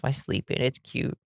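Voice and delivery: soft hushed whisper. Real, not acted. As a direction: whisper it to the sleeping dog. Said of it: "My dog's making noises".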